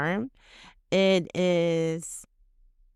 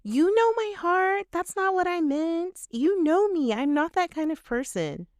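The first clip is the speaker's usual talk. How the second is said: much higher, pleading mock voice; defensive toxic apology; 10 semitones higher than usual. Shown as a demonstration. Mocking someone defending their good heart, high whiny. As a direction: whine it up high, hammed-up and defensive. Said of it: "examples of toxic apology".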